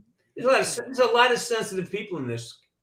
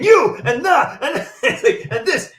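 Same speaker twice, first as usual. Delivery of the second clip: imitating a rant; 6 semitones higher, 5 dB louder, slower than usual. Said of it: "you're getting into camera".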